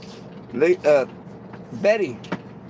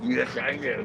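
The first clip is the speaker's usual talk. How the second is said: ordinary talk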